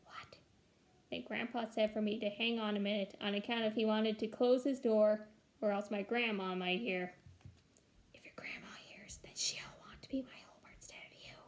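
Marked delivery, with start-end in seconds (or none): 0.0-0.4 a tiny eager whisper; whispered back. Acted. Little girl whispering back to grandpa on the phone. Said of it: "I whispered back at him". 8.1-11.4 a secret, breathy whisper. Acted. Grandpa whispering a secret plan to his granddaughter. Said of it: "made his voice real quiet".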